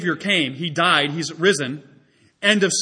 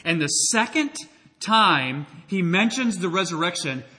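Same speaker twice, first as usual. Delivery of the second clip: normal talking voice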